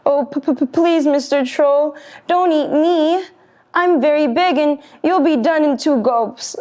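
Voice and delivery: high, stuttering plea; stammering pleading goat. Acted. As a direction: beg the troll in fright. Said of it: "wobbled where he stood".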